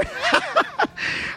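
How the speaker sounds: a burst of high-pitched laughter. Real, not acted. Host laughing at eager listeners, high real laugh.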